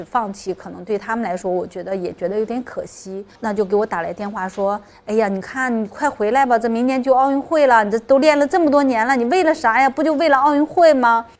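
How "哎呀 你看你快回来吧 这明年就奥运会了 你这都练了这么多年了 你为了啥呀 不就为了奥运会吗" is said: retold exasperated persuasion, pitch pushed up; urgent coaxing. Coach phones athlete who quit before the Olympics, urgently coaxing. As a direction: imitate a coach pleading down the phone, higher and louder.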